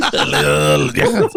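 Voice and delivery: low, dragged-out caveman growl through laughter; caveman imitation. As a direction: mimic a slow caveman while laughing. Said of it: "fucking caveman".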